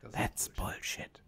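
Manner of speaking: very soft, slow whisper; whispered verdict. Real, not acted. Rejecting advice to accept everything, slow whisper. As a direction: whisper it slowly, as a firm rejection.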